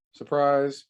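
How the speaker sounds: ordinary delivery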